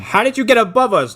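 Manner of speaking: high, loud bewildered cry; astonished mock question. Villain baffled by flying Erickson, loud astonished mock question. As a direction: call up in hammed-up disbelief.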